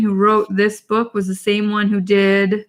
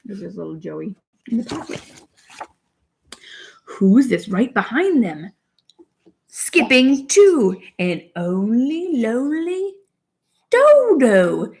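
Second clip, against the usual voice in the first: put-on slow, higher storybook British accent; 8 semitones higher, 3 dB louder, much slower than usual. Mom reads a potty book to her daughter, British accent. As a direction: read it like a cheery British picture book.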